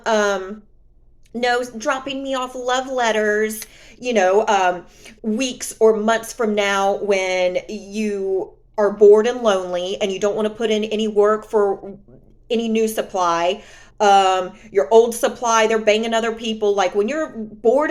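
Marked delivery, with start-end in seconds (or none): none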